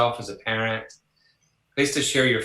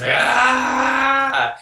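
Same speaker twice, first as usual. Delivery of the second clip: high cry of despair, loud; a sudden anguished shout, high and loud; 13 semitones higher, 6 dB louder than usual. Retold or imitated. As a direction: let out a pained wail of frustration. Crying out to friend on phone.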